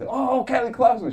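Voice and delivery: high, loud mock wail. Comic yelps the name as the nurse rips the electrodes, high. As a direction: belt the name up high as a gag. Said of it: "hit her with the camera".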